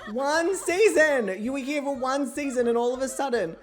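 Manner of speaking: laughing outrage. Real, not acted. Scolding the returned ghost Catherine, laughing and loud. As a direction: laugh through mock indignation.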